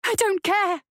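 high-pitched, defiant snap; bitten-out retort. A narrator's reading. Fleeing woman defies the voices, bitten-out high retort. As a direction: bite it out, pitched well up. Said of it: "she bit out".

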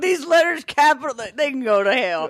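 high, hammed-up indignant voice; mock outrage. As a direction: squeal it in fake fury. Mock outrage at capital letters.

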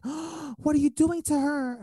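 high, loud, drawn-out mock shriek; outraged mom. Mom confronting the helper carrying her kid, outraged. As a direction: imitate an aghast mother, pitch way up.

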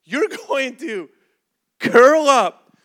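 comic full-volume command; mock-urgent shout. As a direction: belt out the emergency instruction. Mock-urgent expert instruction, blurted.

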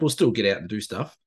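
ordinary talk, nothing marked

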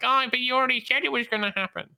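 high, fast mocking whine; whiny complainers. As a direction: mimic the moaning crowd in a squeaky rush. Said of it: "everyone's like".

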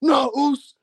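high, hammed-up wounded brother; mock tearful Uso. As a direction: play it as a teary, melodramatic brother. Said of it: "and they're crying".

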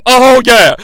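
big punchy cheer; excited commentator. Acted. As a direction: burst out like a hyped-up wrestling commentator.

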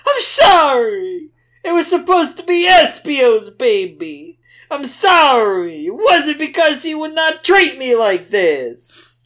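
high, loud, slow hammed-up wailing apology; mock sobbing plea to Knuckles; scared crying plea. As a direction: wail it like a terrified cartoon girl. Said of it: "Rouge was crying and scared".

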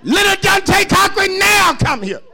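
high, loud mock mama calling out the full name. Pastor imitating his upset mother calling him, loud. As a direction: holler the name like an angry mother. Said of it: "she called your full name".

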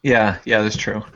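ordinary talk

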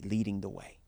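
ordinary talk

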